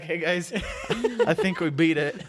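high, loud laughing call. Acted. Character dangling, calls down.

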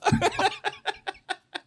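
high burst of real laughter. Real, not acted. Pastor laughing at his own set-up story, high laugh. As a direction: laugh hard at the punchline.

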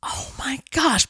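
breathless, slow whispered realization; hushed oh my gosh; stunned whisper. Real, not acted. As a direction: whisper it as the penny drops. Sudden realization about both places.

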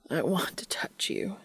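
breathless whispered complaint. A narrator's reading. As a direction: whine it in a whisper. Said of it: "he complains".

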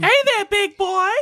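high, loud falsetto come-on; exaggerated falsetto flirt; mock seductress. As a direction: ham up a flirty female voice. Spoof of her female character.

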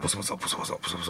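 a hushed mumble too soft to catch; mumbling whisper. Shown as a demonstration. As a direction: mumble it under your breath, barely voiced.

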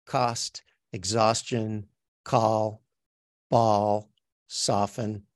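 "cost, exhaustion, call, ball, soften" with the aw vowel, word by word; new vowel drill. Shown as a demonstration. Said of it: "learn this new vowel".

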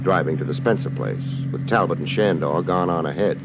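low, dry noir voice-over; detective narration. Acted. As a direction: tell it like a tough private eye looking back. Hardboiled narration over music.

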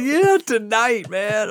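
high, drawn-out retold reply; excited confirmation. Friend confirms the party is now, excited. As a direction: stretch it out, pitched well up.

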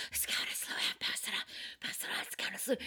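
whispered, hurried pass it on; gossiping whisper. Retold or imitated. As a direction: hiss it to the crowd like hot gossip. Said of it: "people were like".

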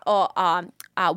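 ordinary delivery

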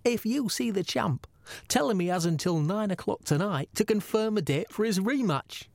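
put-on accent for the commissioner's message; Gary Shagg. Host relays the commissioner's ultimatum to the champ, in an imitated accent. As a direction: read it out in an imitated boss's accent. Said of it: "do it in my best Gary Shagg accent".

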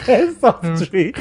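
drawn-out, laughing repeat; laughing echo. Retold or imitated. Host echoing a friend's odd suggestion, laughing. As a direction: repeat it slowly through laughter.

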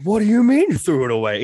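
high, loud, incredulous question; imitated indignant disbelief. Worker confronting coworker who binned his vape, imitated indignant disbelief. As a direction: demand it in rising outrage.

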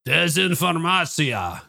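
put-on slow, drawn-out Russian accent on Desinformatia, voice clearly changed. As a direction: sound out Desinformatia slowly like a Russian speaker. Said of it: "give me your best Russian voice".

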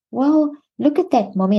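put-on a touch of a little kid pointing something out; imitating a child. Parent voicing her small child.

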